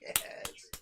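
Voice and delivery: high, elated outburst dropping into hushed yeses; excited celebration. Real, not acted. Streamer finally hears the sound working, excited celebration. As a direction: gasp out your relief and delight. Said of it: "Y'all don't know how excited I am".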